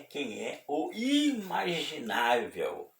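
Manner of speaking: high, slow, stretched-out build-up; dramatic emphasis. Real, not acted. Preacher builds up to who it is, high and drawn out. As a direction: raise the pitch and draw it out.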